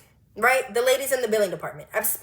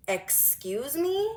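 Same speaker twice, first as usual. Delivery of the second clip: drawn-out, softer questioning rise; affronted disbelief; 3 semitones higher, 6 dB quieter, much slower than usual. Acted. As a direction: stretch it slowly into an incredulous question. Reacting to coworker's rude question.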